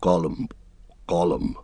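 quiet gurgling Gollum imitation; Gollum throat noise. A narrator's reading. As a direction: swallow the word in the throat like Gollum. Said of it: "made a noise in his throat".

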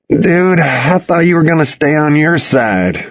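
high-pitched, aggrieved groan of protest; complaining. A narrator's reading. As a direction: complain like someone woken too early. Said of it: "he complained".

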